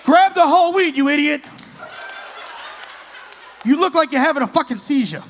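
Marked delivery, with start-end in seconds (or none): 0.1-1.4 mock shouting. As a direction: bark it like a frantic video. Mocking TikTok video shouting.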